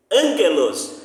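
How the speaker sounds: Ângelos stressed on the first syllable ÂN; Ângelos, hitting ÂN and falling after it. Shown as a demonstration. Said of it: "porque o acento está na proparoxítona". (Ângelos com acento na antepenúltima)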